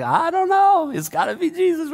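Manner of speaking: very high, giddy squeal of wonder; excited gush. Retold or imitated. Pastor marveling at her conversion, high excited gush. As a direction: gush it way up high, mock-bewildered. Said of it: "I was so excited".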